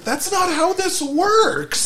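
very high, slow, drawn-out protest; high squeaky disbelief. Retold or imitated. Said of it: "we're like".

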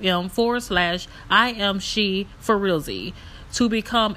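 upbeat sponsor read, loud. Acted. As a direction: read it like a podcast promo. Recorded support ad read.

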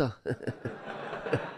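breathy laugh at own joke; real laugh. Real, not acted. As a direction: laugh it out after the punchline. (צחוק אמיתי אחרי הבדיחה)

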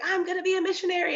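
high, fast, eager declaration; excited announcement. Retold or imitated. Student telling her doubtful advisor her plan, high, fast and thrilled. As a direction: blurt it out with joy.

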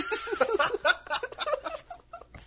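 high giggling burst; high laugh. Real, not acted. Laughs at meme.

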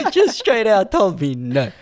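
high, loud laughing speech. Real, not acted. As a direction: laugh through the words, drawing them out. Laughing at the retold refusal.

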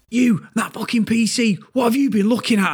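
high, indignant mock interrogation; director's accusation. Director confronting employee over the PC, acted accusing outburst. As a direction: imitate a boss demanding an explanation. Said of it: "They'd never seen me lose my temper".

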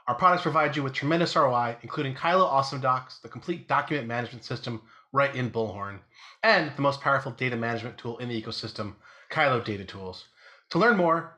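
brisk, upbeat sponsor read. Acted. Host reading a sponsor spot to podcast listeners, upbeat ad read. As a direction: sell the partner to listeners.

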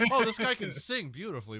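high, laughing astonished quote; marvel, half laughing; mock amazement. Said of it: "it's like".

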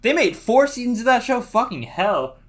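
high, loud disbelief; loud, high-pitched astonished outburst; incredulous. Real, not acted. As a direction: exclaim it in real amazement. Astonished at number of seasons.

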